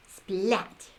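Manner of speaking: ordinary delivery, nothing marked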